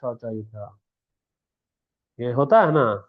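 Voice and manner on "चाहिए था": dropped low and soft, almost to herself; muttered aside. Real, not acted. A teacher muttering the end of her point to the class. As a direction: let it fall away quietly. Aside after demo letter.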